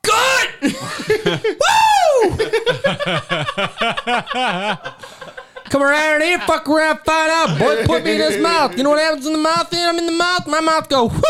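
high, manic bragging voice; cocky shotgun character. Acted. Plays the boastful shotgun.

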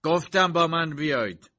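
insistent outburst; shouting. A narrator's reading. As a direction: shout an order impatiently. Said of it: "داد زد".

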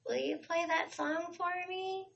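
tiny high, hushed request; really soft plea. Retold or imitated. Nervous fan asks singer for a song, soft and high. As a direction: re-enact a timid little plea, softly. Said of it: "Like, really softly".

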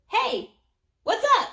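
loud, very high creature voice; perky little angel. Acted. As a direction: pipe up like a tiny cheeky angel. Tiny angel creature first speaks.

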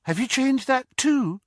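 high, bright question. A narrator's reading. Girl asking about a disguise, bright and high. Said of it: "she asked brightly".

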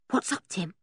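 soft, hushed question to a dog; hushed concern; whispered. A narrator's reading. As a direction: ask it under your breath. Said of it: "whispered George".

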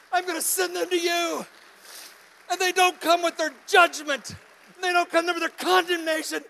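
high, loud, full-force preaching; impassioned preaching. Real, not acted. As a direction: preach it out with rising urgency.

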